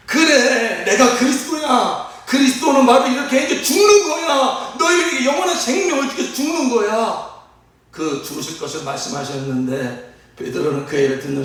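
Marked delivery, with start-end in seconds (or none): 0.1-7.3 high, elated proclamation; overjoyed Jesus. Acted. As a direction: proclaim it joyfully, pitched well up. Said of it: "예수께서 너무 기쁘셔가지고". (기쁨에 차서 높게 선포)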